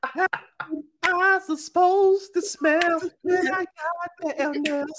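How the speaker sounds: mock voice. Mock cologne buyer.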